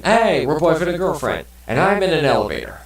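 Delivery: hammed-up lovestruck announcement; mock excited boyfriend. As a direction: act it out like a giddy new couple. Acting out a couple's line.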